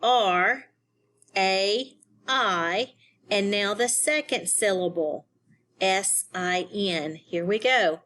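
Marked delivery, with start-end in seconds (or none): none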